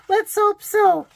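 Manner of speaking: high, loud laughing squeal; "Let's hope so" squeaked high and loud on a laugh; squealed through a laugh. Real, not acted. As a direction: let it burst out high and giggly. Laughing after spotting babies.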